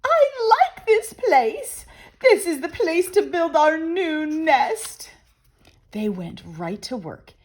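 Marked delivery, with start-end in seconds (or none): 0.0-5.1 high squeaky bird voice, pleased; delighted Missus Bird. Acted. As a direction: claim the place happily. Missus Bird chooses the house.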